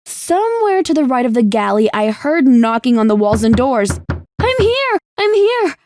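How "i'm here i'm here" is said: high, hoarse, desperate yell; hoarse scream. Acted. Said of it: "I screamed in a hoarse voice".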